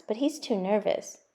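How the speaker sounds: ordinary delivery, nothing marked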